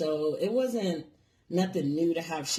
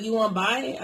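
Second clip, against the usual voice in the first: imitated Chinese accent; 5 semitones higher, 6 dB louder than usual. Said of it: "talking in fucking broken English".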